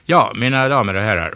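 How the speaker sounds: loud ringmaster welcome; loud announcing. A narrator's reading. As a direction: address the audience like a host. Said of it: "fortsatte Måns högt".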